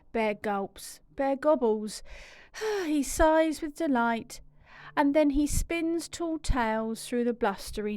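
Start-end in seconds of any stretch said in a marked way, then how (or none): none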